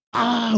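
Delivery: high mock bawl; imitated crying wail. Son bursts into tears, imitated high wail. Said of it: "우는 거예요".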